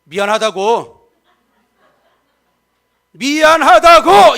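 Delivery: a rising angry sorry that means nothing; grudging angry sorry. Shown as a demonstration. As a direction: spit out the apology in a temper. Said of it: "나 안 풀리면".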